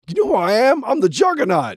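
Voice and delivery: loud, high movie tough-guy boast; Juggernaut. Acted. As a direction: boom it out like a comic-book brute. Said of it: "the guy that played the Juggernaut".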